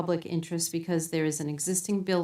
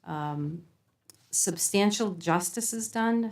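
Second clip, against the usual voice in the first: ordinary talk, nothing marked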